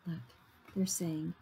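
ordinary delivery

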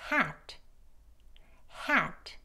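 Hat with stressed vowel and a light released t; Hat as one falling syllable, t barely a puff; weak final t. Shown as a demonstration. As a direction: let the t at the end of Hat fall away softly. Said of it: "소리가 약해져요".

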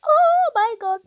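very high shocked squeal. Real, not acted. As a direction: squeal in disbelief. Sudden game moment.

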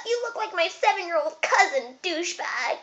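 high-pitched jeer with a laugh; mocking taunt. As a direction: imitate someone laughing at you. Mimics people mocking his looks.

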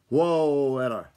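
loud, drawn-out whoa; calling to a horse. A narrator's reading. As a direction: holler to halt the horse. Driver halting the horse.

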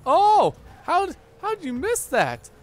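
high, loud disbelief; high-pitched protest at a miss; incredulous outburst. Real, not acted. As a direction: cry out in exasperated amazement. Disbelief at a missed play.

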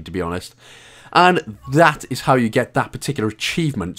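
usual speaking voice

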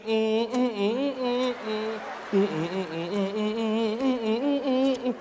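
rhythmic humming like dancing at buffet; rhythmic dance humming. Retold or imitated. Cousin dancing at the buffet, humming mm mm. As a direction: hum a happy eating dance.

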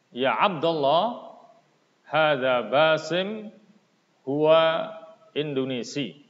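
loud, careful reading of each line of the dialogue; model reading with intonation. Shown as a demonstration. Teacher reads Arabic dialogue to pupils, loud model reading. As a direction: read it out clearly so pupils can copy the tune. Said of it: "silahkan kalian ikuti bacaan Ustadz".

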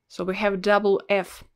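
ordinary talk, nothing marked

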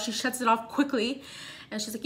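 ordinary talk

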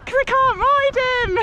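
high, squeaky mock answer; playful high self-mocking voice; mock whiny reply. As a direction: squeak it out like a sheepish excuse. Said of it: "I'm like".

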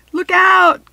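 high, loud warning cry; urgent yell; cried out. Acted. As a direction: shout the warning across the room. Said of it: "Katie cried".

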